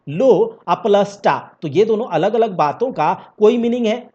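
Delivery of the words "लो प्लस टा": "लो प्लस टा" said slowly, each syllable set apart; word split apart. Shown as a demonstration. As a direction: break "लो प्लस टा" into separate slow syllables. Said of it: "अब लोटा को आप तोड़िए भाई".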